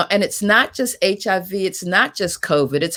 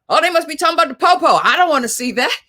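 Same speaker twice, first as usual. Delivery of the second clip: higher, fast, brushing it off as a cop story; dismissive mock reaction; 8 semitones higher, 3 dB louder, much faster than usual. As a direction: rattle it off in a high mocking voice like someone who won't watch. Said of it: "some people might start thinking about".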